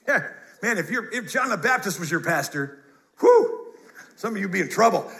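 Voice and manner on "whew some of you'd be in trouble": normal talking voice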